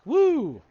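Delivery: loud, high-pitched whoop; group cheer. Acted. Game master voicing the crew behind their leader, cheering loudly. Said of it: "just like".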